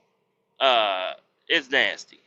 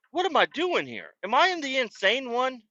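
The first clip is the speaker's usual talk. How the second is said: exasperated disbelief; 11 semitones higher than usual. Retold or imitated. Exasperated question about driver.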